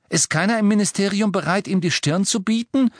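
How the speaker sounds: höher und schneller, empörte Frage; zornig. Acted. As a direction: Ron platzt vor Wut heraus. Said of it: "fragte Ron zornig". (Ron fragt empört nach dem Ministerium, zornig)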